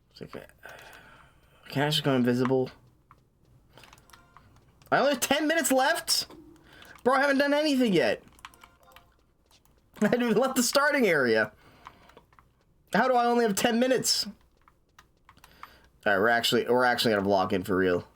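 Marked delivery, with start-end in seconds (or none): 4.9-14.3 high, fast incredulous outburst; can't believe the timer; shocked disbelief. Real, not acted.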